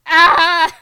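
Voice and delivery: high mock scream of dismay; a high, loud mock cry; retold scream. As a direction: shriek it in mock horror. Yuri screams in retold scene.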